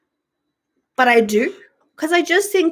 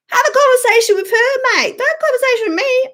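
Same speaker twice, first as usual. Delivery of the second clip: venting at commenters, exasperated; fed up outburst; 11 semitones higher, faster than usual. Real, not acted. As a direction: fire it back, higher and fast. Ranting at commenters.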